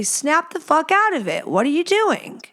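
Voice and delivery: friend snapping her out. Retold or imitated. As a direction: jolt her awake with a sharp high scold. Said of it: "slap me across the face and say".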